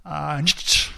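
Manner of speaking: ah-Nietzsche sneezed out like an achoo; sneeze imitation. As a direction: turn the words into a sneeze. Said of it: "il éternue régulièrement en faisant". (mimer un éternuement sur « Ah, Nietzsche »)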